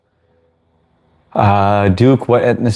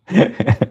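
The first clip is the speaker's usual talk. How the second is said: burst of laughter; 11 semitones higher than usual. Real, not acted. Laughs at his own joke.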